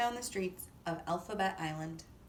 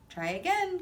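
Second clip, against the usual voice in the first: higher, louder call to try again; exclaiming; 9 semitones higher, 5 dB louder than usual. Acted. Teacher letter urging the alphabet, loud exclaim. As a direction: urge them on brightly. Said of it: "capital T exclaimed".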